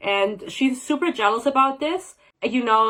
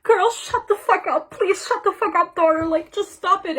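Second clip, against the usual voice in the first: mock frantic mom; 8 semitones higher than usual. Mom pleading with her crying daughter, hammed-up high yell. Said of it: "She's like".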